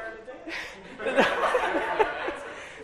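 high amused laugh; laughter. Real, not acted. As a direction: chuckle at the memory. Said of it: "laughs remembering".

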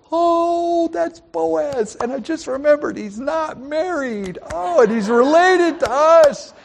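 put-on high, excited old woman's voice; Naomi voice. Preacher voicing Naomi realizing Boaz is kin, high and excited. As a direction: squeal it out like a delighted mother-in-law. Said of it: "I'd love to do that with a Hebrew accent".

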